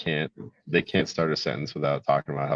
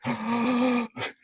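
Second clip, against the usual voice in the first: put-on high, sharp gasp of a shocked child; child's gasp; 4 dB quieter than usual. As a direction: gasp like a stunned toddler. Child shocked at crayon eating.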